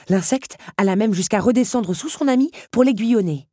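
ordinary delivery

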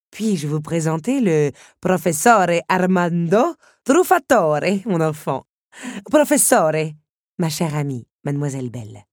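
grand countess making introductions; countess introducing. A narrator's reading. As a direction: present the guest with ceremony.

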